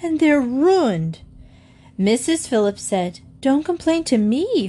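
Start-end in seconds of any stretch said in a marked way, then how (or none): 0.0-1.2 high, drawn-out cry of outrage; shouted. A narrator's reading. Husband shows wife his ruined best tools, shouting. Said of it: "he shouted". 3.4-4.7 high, exasperated retort; upset. A narrator's reading. Wife fires back at complaining husband, upset. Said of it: "and she began to cry".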